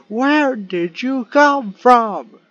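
high, slow, loud disbelief; shocked. Real, not acted. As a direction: drag it out, still stunned. Still reeling from jumpscare.

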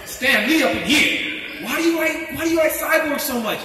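high, worked-up protest to a son; exasperated dad. Retold or imitated. Marvel dad challenging his son over Cyborg, exasperated. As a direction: plead loudly, pitch climbing, like a baffled Marvel fan.

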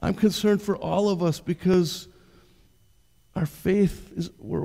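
ordinary talk, nothing marked

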